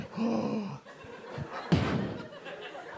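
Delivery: long mock gasp; shocked gasp. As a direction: gasp theatrically at the twist. Room reacts to the twist.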